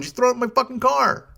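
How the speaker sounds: indignant. Retold or imitated. Driver argues back.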